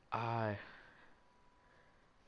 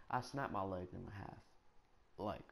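ordinary delivery

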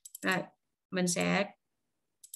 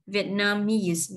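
"Vietnamese" slowly, stressing the last syllable, MESE; stress on third syllable; much slower than usual. Shown as a demonstration. Teacher showing where the stress falls, slow "Vietnamese" stressed on MESE. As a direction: draw out "Vietnamese" with the weight on MESE. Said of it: "dấu nhấn nó nằm ở vần ba".

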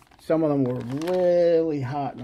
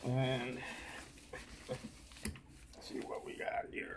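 whispered to himself; a soft unvoiced whisper to himself; 15 dB quieter than usual. Real, not acted. Muttering to himself while unboxing.

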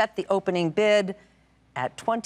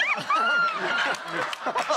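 high laugh. Real, not acted. Host laughing with contestant.

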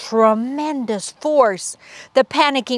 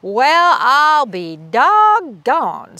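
very slow, louder marveling drawl; amazed squeak; 5 semitones higher, 6 dB louder, much slower than usual. Acted. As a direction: savor the surprise. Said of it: "squeaked Big Cheese".

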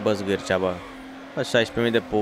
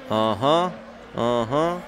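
ordinary talk, nothing marked

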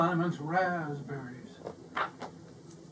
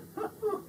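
gloating laugh; 6 dB quieter than usual. A narrator's reading.

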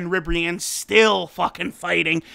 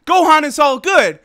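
higher, louder put-on voice; a lightly cartoonish relieved chorus; mock characters' voice; 6 semitones higher, 6 dB louder than usual. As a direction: play the onlookers, cheerfully relieved. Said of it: "they're like".

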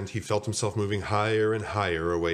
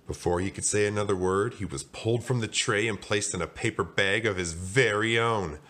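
ordinary delivery

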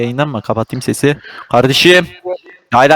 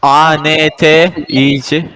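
spelled out slowly; much slower than usual. Shown as a demonstration. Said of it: "spelling the site for friend".

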